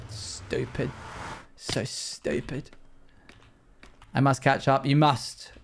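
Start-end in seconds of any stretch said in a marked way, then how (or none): 0.1-2.7 breathy whisper, slow and soft; whispered self-scolding. Real, not acted. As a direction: scold yourself under your breath. Muttering at own mistake.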